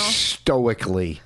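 drawn-out, low "Stoically"; mocking her word. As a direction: savour the word with scorn, very slowly. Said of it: "you use words like".

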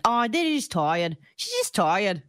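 broad mock-Australian, "oy" in tired; playful Australian impression; Australian accent. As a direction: imitate Australian reality-show chatter. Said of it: "were in an Australian accent".

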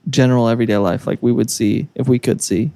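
usual speaking voice

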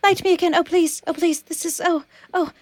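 high, faltering, desperate little voice; pleading, dying candle. Acted. Candle begging the person who lit her, high frantic pleading. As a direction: beg haltingly as if about to be snuffed out. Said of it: "a candle trying to warn the person".

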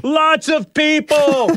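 high, slow pretend shout; hammed-up yell, every word stretched out; mock street yelling. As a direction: holler it as a make-believe sound caption. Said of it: "I'm gonna go out in the street".

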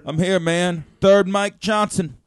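loud, drawn-out name announcement; mock self-announcement. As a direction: announce yourself big and loud, playfully. Playful self-introduction as extra mic.

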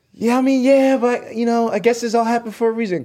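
very high mom voice; imitating mom. Traded player's mom consoling him by phone, high-pitched imitation. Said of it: "you can hear she's like".

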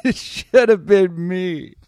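high, slow, loud anguished wail; anguished survivor. Pretend. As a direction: drag out the lament melodramatically. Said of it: "the guy in the other track's like".

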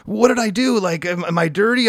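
high, panicky plea to the doctor; mock panic. A nervous patient asking the doctor, hammed-up panic. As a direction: squeak it out like a worried patient.

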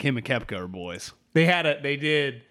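usual speaking voice

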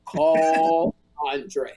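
low, slow divine voice breaking into a laugh; a mock heavenly command with a laugh in it; voice of God. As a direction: play God again but crack up halfway. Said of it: "but the voice said".

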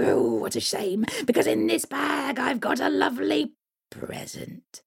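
high sly coaxing hag; troll hag. Acted. Troll hag tempts the boy, sly and coaxing. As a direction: dangle the present sweetly.

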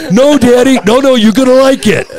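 put-on high, eager child pleading; little kid voice. Five-year-old son answering dad about the motorcycle, high childlike plea. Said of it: "It's gonna scare the shit out of you".

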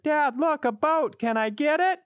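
put-on high-pitched kid calling to dad; small boy's high, eager voice; excited little son. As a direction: pipe up high like a curious young boy. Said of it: "his son's like".

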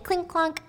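clink clonk said high and light, like drops hitting a bucket; high clink clonk sound effect. Retold or imitated. Summing up the prison bucket, a playful high clink clonk.

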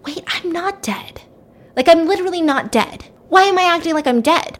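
high-pitched dawning disbelief; incredulous inner voice, rising in pitch; sudden realization. Retold or imitated. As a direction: voice jumps up as it dawns on her. Character's sudden realization in thought.

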